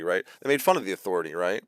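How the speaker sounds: ordinary delivery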